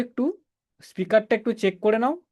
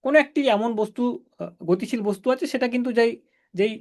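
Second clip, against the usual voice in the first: ordinary talk, nothing marked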